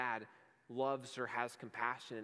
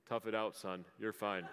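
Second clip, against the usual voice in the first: a lower, gruff-ish dad brushing it off; 5 semitones lower than usual. Retold or imitated. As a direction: play the tough dad, lightly, with a laugh at the end. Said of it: "in my best dad voice".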